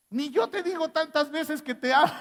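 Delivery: wife mocking the gushing friend, laughing. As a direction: tease it through a laugh. Wife's jealous remark after audio.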